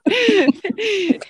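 high bright burst of laughter. Real, not acted. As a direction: laugh at own impression. Laughing after impression.

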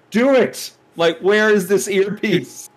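high, loud mock command; mock incredulous exclaiming. Host mocking Steve's orders without an earpiece, high incredulous exclaiming. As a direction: exclaim it high, drawing out the question.

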